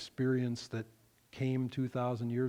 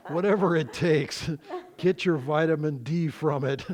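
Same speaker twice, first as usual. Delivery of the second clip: loud, amused, laughing through the words; tickled by your own quip; 4 semitones higher, 7 dB louder than usual. Real, not acted. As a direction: joke loudly over your own chuckles. Joking about sunburn from light.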